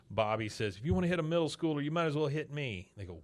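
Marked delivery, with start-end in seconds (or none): 0.8-2.9 fast, higher rushed challenge; mock heroic. Bobby shielding the middle schooler, fast high challenge. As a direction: blurt it out bravely, all in one breath.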